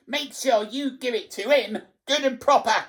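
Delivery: high, loud spiteful urging; piercing harridan's goading; high-pitched screeching. A narrator's reading. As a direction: screech it, demanding punishment. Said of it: "high-pitched voice behind me screeching".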